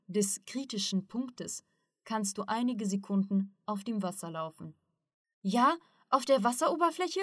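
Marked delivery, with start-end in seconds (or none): 5.4-7.2 higher, delighted disbelief; joyful exclamation. A narrator's reading. Ruslan hears he could run on water, joyfully exclaiming. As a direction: exclaim it with rising, happy amazement. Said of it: "rief Ruslan freudig aus".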